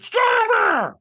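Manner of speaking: full-voiced scream, very high and loud; screamed command. Trainer screaming at a hesitant firewalker, screamed command. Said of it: "he screamed at me".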